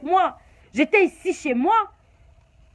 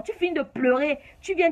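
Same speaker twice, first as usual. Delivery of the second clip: normal talking voice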